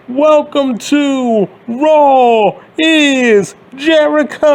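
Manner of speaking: Chris Jericho catchphrase. Retold or imitated. Mocking a wrestler's entrance line, high and slow. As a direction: stretch it slowly like a ring entrance. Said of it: "Chris Jericho used to be like".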